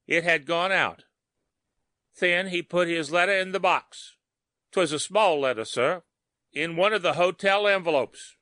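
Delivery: dutiful cop reporting to his superior; policeman voice. A narrator's reading.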